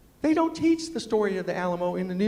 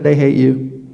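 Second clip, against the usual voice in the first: blunt punchline; 6 semitones lower, 13 dB louder, slower than usual. Retold or imitated. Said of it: "the book said".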